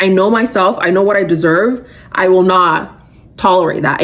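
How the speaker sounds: ordinary talk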